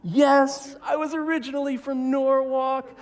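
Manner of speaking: very high, loud cracking confession; breaking confession. Retold or imitated. Husband breaks down and confesses in a high strained voice. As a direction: crack and admit it all. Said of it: "He's like".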